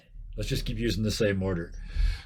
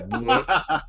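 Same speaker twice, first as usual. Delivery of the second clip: laughing disgusted bleh; 4 dB louder than usual. Acted. Disgusted sound at ooze.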